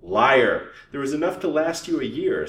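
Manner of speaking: loud, angry accusation. A narrator's reading. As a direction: burst out loudly at a cheat. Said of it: "said Missus Vance angrily".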